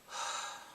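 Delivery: soft, voiceless sigh of sadness; heavy sigh. Real, not acted. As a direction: breathe out a saddened sigh. Sad over the scene.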